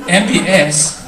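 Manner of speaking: put-on casual Filipino-accented English; stand-up storytelling in a Filipino accent. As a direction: talk like Manila hotel staff, in a Filipino accent. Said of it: "they talk with this kind of accent".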